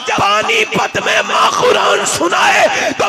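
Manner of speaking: high, slow, shouted sermon climax; full-force preaching. Real, not acted. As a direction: roar each phrase out slowly to the crowd.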